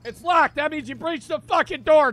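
ordinary delivery

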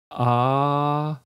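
long held A, tongue relaxed; A drawn out evenly; open A. Shown as a demonstration. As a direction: sustain an open A on an even pitch. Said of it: "beim offenen A ist die Zunge entspannt".

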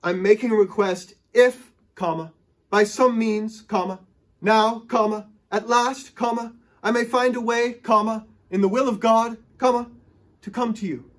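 high, loud, deliberate comma-by-comma reading. Shown as a demonstration. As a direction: read it aloud with each "comma" spoken. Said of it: "He says".

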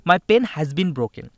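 slow emphatic re-read. Shown as a demonstration. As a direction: hammer the sentence out again word by word. Teacher re-reading the question.

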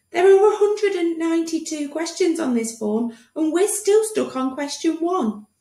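high-pitched fretting doctor; flustered doctor. Acted. Doctor to patient, anxious high voice. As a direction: panic over endless paperwork.

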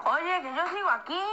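high, loud whiny cry; mock wailing complaint. Reminding the chat they are still here, whining loudly. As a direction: wail it to get noticed.

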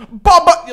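loud, high "ba, ba"; mock musical outburst. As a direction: burst out loudly, imitating him. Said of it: "just busts out into".

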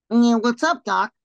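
cheeky cartoon rabbit drawl; Bugs Bunny voice. Cartoon voice medley.

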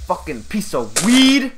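loud furious curse; angry shout. Real, not acted. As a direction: yell it in frustration. Angry after being hit.

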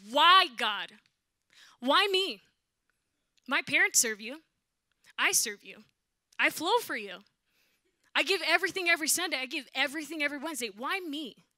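loud, reenacted crying-out in prayer; anguished plea to God. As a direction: cry it out in wounded protest. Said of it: "I'm gonna literally replay what happened".

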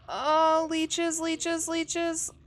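real panicked yelp. Real, not acted. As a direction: recoil from the leeches. Leeches attacking her character.

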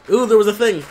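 high, loud excited yelp; suddenly spot something exciting. Real, not acted. Spots an item mid-game.

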